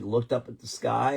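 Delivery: ordinary talk, nothing marked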